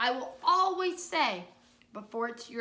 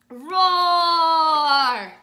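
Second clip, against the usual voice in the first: very loud, higher, sustained roar; strong loud roar; 5 semitones higher, 17 dB louder than usual. Shown as a demonstration. Teacher demonstrates the big dinosaur, loud roar. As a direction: roar at full forte without screaming. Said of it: "so a loud voice".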